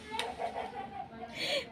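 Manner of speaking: a breathy, soundless giggle; laugh. Real, not acted. Amused at herself while telling viewers, breathy giggle.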